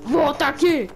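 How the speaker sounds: grito alto de perseguição; shouting. Acted. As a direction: chame alto pra voltar.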